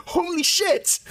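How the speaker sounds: high startled exclamation; shocked midwife. Retold or imitated. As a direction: blurt it out in shock.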